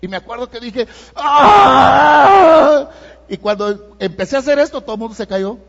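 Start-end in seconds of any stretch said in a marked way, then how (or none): 1.2-2.9 loud, high wailing cry letting everything out; cry of release. Retold or imitated. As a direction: howl it out like a long-held release. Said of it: "mi mejor grito de desahogo". (grito de desahogo, fuerte y agudo)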